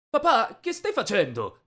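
high, loud cry of disbelief; appalled protest. A narrator's reading. Son seeing his father beg, shocked protest. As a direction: start high and drop, aghast.

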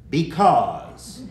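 loud, drawn-out brush-off, falling; a hungry, tired grown-up fobbing off a question; cranky grown-up answer. Acted. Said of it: "when they're hungry or cranky or sleepy".

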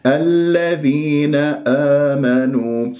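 الذين آمنوا slowly, each long vowel two beats; slow tajweed model of الذين آمنوا; measured recitation. Shown as a demonstration. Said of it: "مد طبيعي حركتين".

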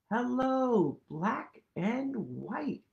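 high, lively picture-book voice; reading to children. Acted. Teacher reading a picture book to kids, lively. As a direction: read it bright for little ones.

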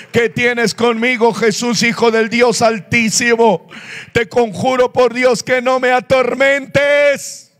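dramatic retold outcry to Jesus; possessed man pleading. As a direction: beg loudly, stretching out the final plea. Acts possessed man begging Jesus.

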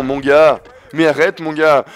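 ordinary delivery

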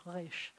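quiet "resh" with a throaty r; hushed guttural Israeli r on "resh"; Israeli back R. Shown as a demonstration. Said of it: "way back here".

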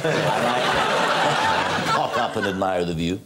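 slow, low comedian impression; Ronnie Corbett. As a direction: lightly imitate a comic, drawn out and low. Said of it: "I'm doing Ronnie Corbett".

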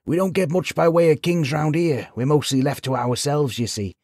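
put-on high old village man; chatty wizened old fellow; old villager voice. A narrator's reading. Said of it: "said the old man with a chuckle".

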